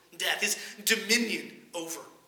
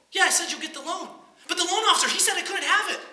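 flustered protest; 8 semitones higher, 4 dB louder, faster than usual. Acted. Customer arguing with president.

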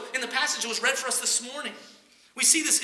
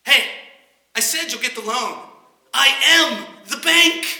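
louder, emphatic, slowing on the bank; irritated; 3 semitones higher, 11 dB louder than usual. Acted. Bank president to stubborn customer, irritated and firm. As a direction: put him in his place, firmly. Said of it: "respond in a little bit of irritation".

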